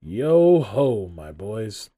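loud, hearty hail dropping off at the end; jovial old master. A narrator's reading. Old master greeting his apprentices, jovial. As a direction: boom out merrily to the lads. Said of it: "said Fezziwig".

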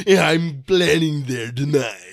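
a hint of a sneering cartoon baddie; mustache twirling villain. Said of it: "mustache twirling".

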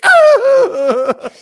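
high, loud shriek; mock shriek. Widow turning to the camera, loud high shriek. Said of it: "She's like".

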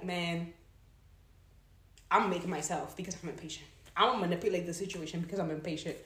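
low, soft, grudging aside; muted self-talk. Acted. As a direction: say it low, as if to oneself. Acted resigned self-talk.

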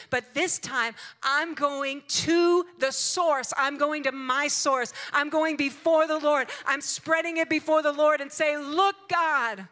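high, loud, emphatic preacher's delivery; full-force preaching. Retold or imitated. Preacher urging grace for the troubled, full-force preaching. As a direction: proclaim it to the congregation, loud and high.

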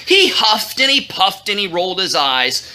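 usual speaking voice

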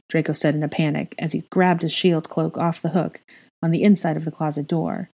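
usual speaking voice

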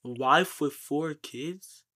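usual speaking voice

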